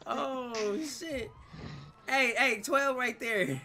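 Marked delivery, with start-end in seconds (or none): 2.1-3.6 loud, raised lookout's alert; louder, higher heads-up; urgent warning call. Pretend. Warning others that police are there.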